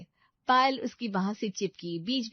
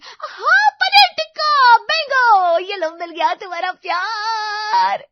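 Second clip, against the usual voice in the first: very high, loud, drawn-out delight; gushing, shrieky girlfriend triumph; overexcited girlfriend; 13 semitones higher, 15 dB louder, slower than usual. Acted. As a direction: squeal the treat out like a grand reveal. Voices Payal announcing the treat.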